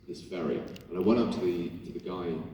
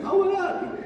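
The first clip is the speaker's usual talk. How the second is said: much higher startled "Oh, my God."; mock fan gasp; 4 dB louder, slower than usual. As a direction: squeal like a shocked fan. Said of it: "they'll go".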